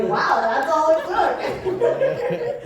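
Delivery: loud, high-pitched comic disbelief; mock amazement. Daughter reacting to mom's praise, loudly amazed. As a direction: exclaim it high, tipping into laughter. Her amused reaction to mom.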